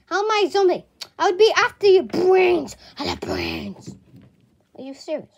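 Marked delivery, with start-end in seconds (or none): 0.1-2.8 indignant. Acted. Indignant zombie denial. 3.0-3.9 very low groaning zombie voice. Acted. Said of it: "That's your most zombie impression".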